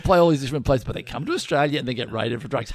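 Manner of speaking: ordinary talk, nothing marked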